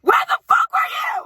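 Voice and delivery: high, loud angry yell; furious yell. Retold or imitated. Worker screams at the returning mother, furious. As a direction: let her have it, shrieking.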